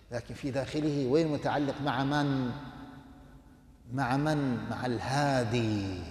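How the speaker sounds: normal talking voice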